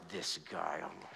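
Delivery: low, soft mock-disapproving woman; mocking wives. Preacher mimicking wives judging the husband, soft and low. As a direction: imitate a wife sighing at the dishes. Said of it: "And you women are like".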